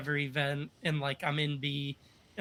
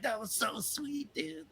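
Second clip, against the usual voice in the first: hyped up; 12 semitones higher, 5 dB quieter than usual. Retold or imitated. Both hyped after tandem.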